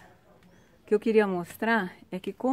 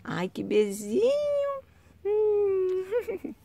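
mock-tearful cooing; 13 semitones higher, 4 dB louder, much slower than usual. Real, not acted. Moved by the dog's kiss.

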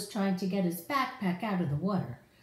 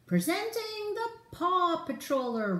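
higher, proud announcer voice for Ryder; announcing; 10 semitones higher than usual. Acted. Ryder presenting the truck to pups, announcing. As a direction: present it like a showman. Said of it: "Ryder announced".

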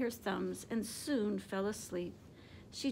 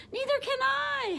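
much higher, louder howling wail; howled; 6 dB louder than usual. A narrator's reading. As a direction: wail it out in fright. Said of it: "howled Flitter".

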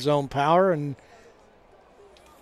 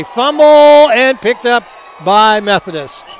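excited shout; 7 semitones higher, 10 dB louder than usual. Real, not acted. Fumble called on live play.

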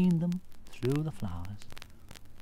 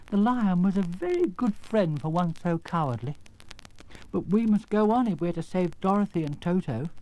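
lightly voiced Scarecrow, higher and louder; regret, then resolve to go on; regretful, resolute Scarecrow; 5 semitones higher, 4 dB louder than usual. Scarecrow speaking of his fallen friend, resolute.

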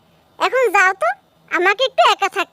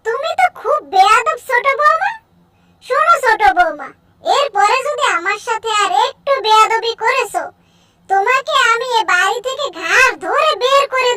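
put-on higher, scolding old mother-in-law voice; 4 semitones higher than usual. Mother-in-law scolding the sleeping daughter-in-law, old scolding voice.

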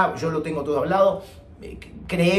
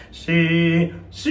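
falsetto demo; 3 dB louder than usual. Shown as a demonstration. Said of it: "te privas de hacer".

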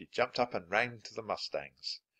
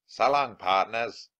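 louder cowboy farewell call; 7 dB louder than usual. Acted. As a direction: call it back over the shoulder. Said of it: "he called".

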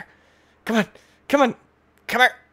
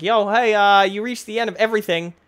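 casual, higher and louder mock announcer; imagined game announcement; 7 semitones higher, 6 dB louder than usual. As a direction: hail the player with offhand cheer. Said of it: "It's like".

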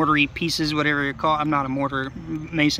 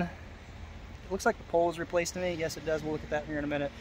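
much softer, half to himself; quiet aside; 13 dB quieter than usual. Real, not acted. Breaks off for a side remark.

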